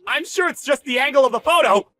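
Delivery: high, loud panicky excuse; alarmed, grasping protest; desperate denial. Acted. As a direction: insist frantically, voice pushed up. Boss desperately denies the truth.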